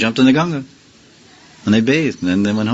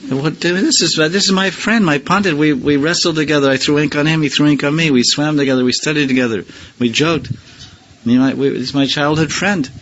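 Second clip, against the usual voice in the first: put-on higher, incredulous young friend; voicing Gadadhar; 5 semitones higher than usual. Gadadhar protesting to his teacher about the Acharya, higher and incredulous. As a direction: play the baffled childhood friend, pitched up. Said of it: "Gadadhar Pandit says".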